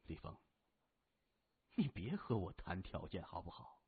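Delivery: low, quiet, controlled menace; cool gang boss. A narrator's reading. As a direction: speak low and unhurried to a captive. Captor threatens his hostage. (低沉冷静的黑帮老大)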